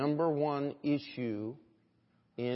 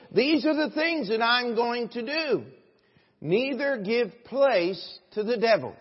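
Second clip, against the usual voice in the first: raised, higher, forceful sermon voice; preaching at full force; 7 semitones higher, 8 dB louder, slower than usual. Real, not acted. Preacher urging the church to put away lying, forceful exhortation. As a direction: drive it home to the congregation.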